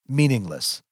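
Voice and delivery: ordinary delivery, nothing marked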